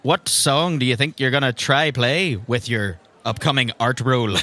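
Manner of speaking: ordinary talk, nothing marked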